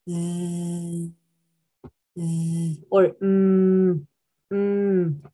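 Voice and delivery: sustained low "mm" hum; steady droned "mm" for learners; voiced vibration demo. Shown as a demonstration. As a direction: hold a buzzing "mm". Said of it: "en la garganta siente vibración".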